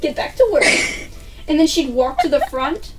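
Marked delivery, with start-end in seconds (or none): 0.0-0.6 a hint of a scolding teacher; mock strict teacher. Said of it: "she's like".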